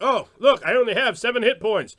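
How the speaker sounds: loud, high out-of-character table talk; mock a player calling out game numbers. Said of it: "you're like".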